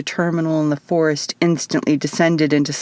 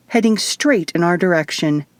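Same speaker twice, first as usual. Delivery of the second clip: ordinary delivery, nothing marked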